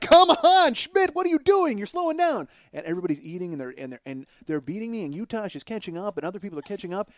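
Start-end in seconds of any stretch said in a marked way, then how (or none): 0.0-2.5 loud shouted heckling; loud jeering yell; crowd yelling. Retold or imitated. Crowd yelling at him in contest.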